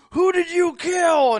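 loud, slow, dramatic ghost hunter yell; mock ghost hunter. Mocking the TV ghost hunter calling out to the dead.

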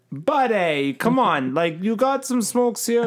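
high, stretched-out mock Canadian accent; Canadian accent attempt. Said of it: "I'll give it a go".

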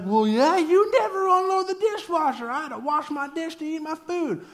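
high, loud comeback; hammed-up retort in a quarrel; mock defensive spouse. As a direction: fire it back at the partner, indignant. Other spouse snaps back.

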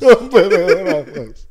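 mock Spanish accent. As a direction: slowly parrot the phrase in a fake Spanish accent. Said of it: "It's the accent".

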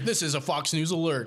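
lightly mocking news-alert announcement; mock news announcer. Cohost spoofing a cable news alert, mock announcer.

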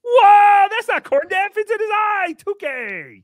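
squeaky high excited character voice; high excited impression. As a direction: pitch way up and exclaim. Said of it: "An incredible impression and costume".

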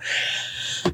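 breathy weary sigh; exasperated sigh. Real, not acted. About to gripe about the train, a weary sigh. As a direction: let out a tired sigh.

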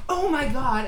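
retold mortified shock, amused; embarrassed realization. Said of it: "she was so embarrassed".

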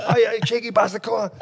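high, indignant telling-off; retold outrage. Telling mates how he rebuked the driver, high mock indignation. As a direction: act out a high-pitched, offended scolding.

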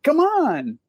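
high, pleading rock star imitation; mock exasperated plea. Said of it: "he's doing the [name] thing".

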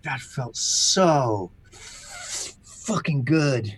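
relieved. Retold or imitated. Relief after the beating.

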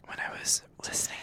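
hushed ASMR whisper. Acted. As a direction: keep it a soft whisper.

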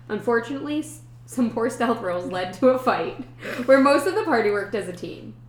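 lightly dramatic campaign recap; story recap narration. Acted.

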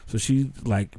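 ordinary talk, nothing marked

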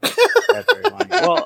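high laughing voice. Real, not acted. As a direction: laugh through the words. Laughs at friend's mistake.

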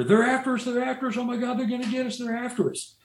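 high, frantic retold panic; imitating terrified men yelling for help; panicked screaming. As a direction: cry it out like you're being chased. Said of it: "screaming bloody murder".

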